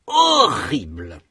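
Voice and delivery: slow, HO of horrible stressed; emphatic stress. Shown as a demonstration. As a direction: insist on the first syllable of horrible. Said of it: "Répétez".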